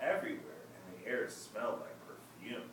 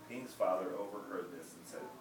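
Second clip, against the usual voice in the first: plain reading voice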